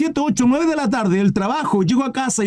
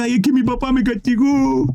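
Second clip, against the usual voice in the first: mock childish whining; 4 semitones higher than usual. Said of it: "Llorando como un niño".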